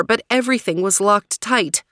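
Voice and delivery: plain reading voice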